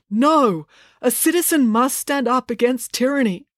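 acted indignant defiance; defiant proclamation. A narrator's reading. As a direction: proclaim it like a rallying speech. Dad makes a defiant stand.

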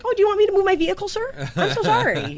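very high and fast groveling; moronic polite voice. Retold or imitated. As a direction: grovel like a total jackass. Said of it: "I was just like".

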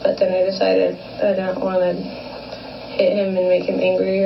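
ordinary delivery

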